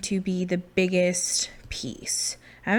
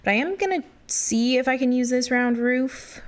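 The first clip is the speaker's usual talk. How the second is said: normal talking voice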